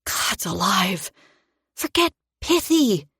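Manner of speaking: sharp hiss through teeth. A narrator's reading. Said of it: "I hissed through my teeth".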